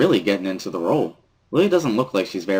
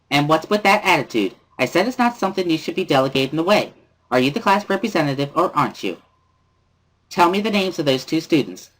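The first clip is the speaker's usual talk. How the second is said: put-on high, bright-spirited girl pressing hard; sing-song scolding of the class rep; cheerful Misha; 8 semitones higher than usual. Misha scolding Lily about the late budget, high and chirpy. Misha relaying furious signing.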